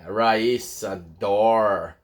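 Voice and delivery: RAISSA and DOOR with a drawn English R, low; caipira R. Shown as a demonstration. Teacher demonstrates the English R, caipira-style R in RAISSA, DOOR. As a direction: pull the R back in RAISSA and DOOR. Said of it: "Esse som mais caipira".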